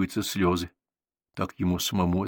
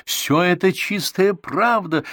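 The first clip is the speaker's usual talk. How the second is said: higher, louder, slow insistent exclaim; 10 semitones higher, 5 dB louder, slower than usual. A narrator's reading. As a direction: insist loudly that it's true. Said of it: "воскликнул я".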